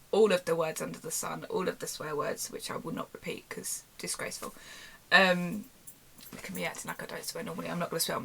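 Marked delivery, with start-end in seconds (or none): 2.5-4.5 hushed whisper, conspiratorial; whispered mock-prim aside. Real, not acted. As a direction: whisper the aside under your breath. Aside about swear words.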